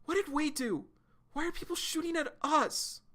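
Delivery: high, pleading teary voice; high, lightly acted tearful plea; teary kid voice. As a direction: ask it near tears, high up. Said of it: "[name]'s starting to tear up".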